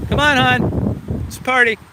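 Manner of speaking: high, very slow sing-song coaxing; a pet owner drawing out each word to coax his dog; coaxing dog talk. Real, not acted. Man coaxing his dog up the scenic turnout in high dog-talk.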